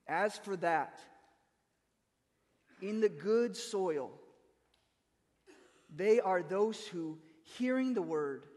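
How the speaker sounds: slow, deliberate scripture reading with long pauses. Shown as a demonstration. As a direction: read each phrase slowly, letting it sink in. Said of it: "So I'm reading".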